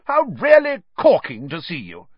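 high, loud, hammed-up greeting; mock-pompous gushing. A narrator's reading. Fred seizing his mother's hand, mock-pompous gushing. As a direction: gush with feigned delight.

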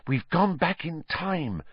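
usual speaking voice

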